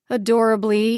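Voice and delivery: ordinary delivery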